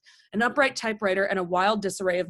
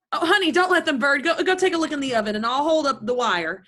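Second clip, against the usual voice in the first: high, louder, hurried warning; acted alarm; 4 semitones higher, 4 dB louder than usual. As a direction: send her urgently to the oven. Food burning during phone call.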